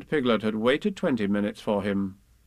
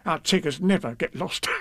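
Tigger voice; 4 semitones higher than usual. Acted.

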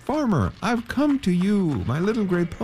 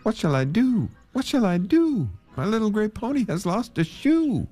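high, wailing cry of distress; a man's lament over his pony; 14 semitones higher than usual. Acted. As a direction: wail it out in despair. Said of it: "he cried again".

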